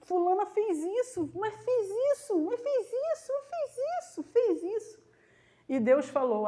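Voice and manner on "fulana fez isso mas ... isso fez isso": whiny mock complaint climbing higher with each repeat; high, fading mock nagging to God; queixa insistente. Imita a própria queixa insistente a Deus. (queixa insistente, cada vez mais aguda)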